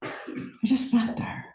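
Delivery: very soft, slow, whispered repeat; near-whisper. Real, not acted. Speaker relives sitting still on the cliff, whispering slowly. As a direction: let it sink almost to a whisper.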